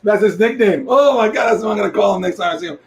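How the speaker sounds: thrilled by a funny discovery; excited. Real, not acted. Delighted at the nickname.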